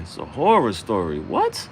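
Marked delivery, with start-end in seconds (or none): 1.2-1.7 shocked squeal. Acted. Horror story punchline.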